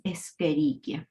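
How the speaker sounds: "Escherichia" with each CH as a hard K; "Escherichia" in the Latin way, K instead of CH; correct Latin pronunciation. Shown as a demonstration. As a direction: say "Escherichia" as es-ke-RI-kia. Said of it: "la pronunciación correcta es".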